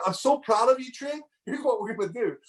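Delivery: dad bursting with pride to his son, high and excited; excited praise. Real, not acted. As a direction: lift the voice, overflowing with pride. Dad praises son after speech.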